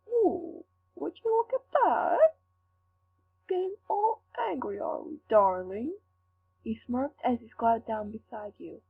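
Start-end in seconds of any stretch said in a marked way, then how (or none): 0.1-6.0 mocking taunt. Villain taunts the angry captive.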